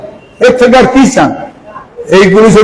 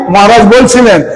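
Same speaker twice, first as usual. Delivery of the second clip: ordinary talk, nothing marked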